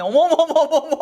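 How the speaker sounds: high, loud, laughing oh-my's; amazed squeal. Real, not acted. To the mirror, amazed at her own look, squealing. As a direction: gush at the mirror.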